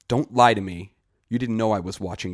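loud, heated accusation; angry accusation. Acted. Punka accuses Greet.

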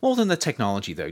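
plain reading voice

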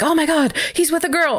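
high, gushing proud mom; excited mom gasp. Retold or imitated. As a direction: squeal it like a thrilled mother. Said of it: "And then Beverly's like".

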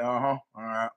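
low, soft, absent-minded acknowledgment; half-listening from the couch; uninterested. Retold or imitated. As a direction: murmur it without caring. Buddy on couch watching TV.

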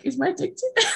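high-pitched, giggly eager question; mock excitement. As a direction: imitate him getting thrilled, half laughing. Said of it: "he gets so excited".

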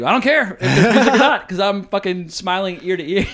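loud, high and laughing, carried away with fun; gleeful laughing. Retold or imitated. A film fan recalling loving a brutal movie, gleeful laughing. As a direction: laugh it out, loving every gross minute. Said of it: "So I was like".

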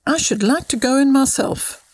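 lightly acted childish enthusiasm; eager cry. A narrator's reading. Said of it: "cried Kate".

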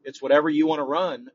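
normal talking voice

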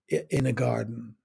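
ordinary talk

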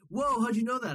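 high-pitched astonished reaction; amazed. Retold or imitated. A stranger reacts to having his home province guessed, amazed. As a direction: mimic someone stunned by a lucky guess.

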